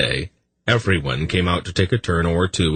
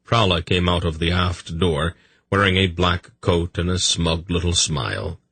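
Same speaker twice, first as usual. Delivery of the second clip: ordinary delivery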